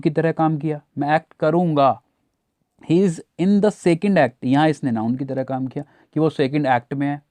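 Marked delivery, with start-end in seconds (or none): 2.9-4.4 each word laid out slowly; teacher spelling out an example at a crawl; slow example read. Shown as a demonstration. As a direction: read the sentence to the class very slowly. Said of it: "जैसे यहाँ".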